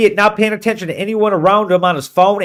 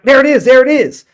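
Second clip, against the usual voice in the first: retold excited shout, higher and louder; excited spotting; 6 semitones higher, 4 dB louder than usual. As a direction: call out the find with a jolt. Nephew spots the phone.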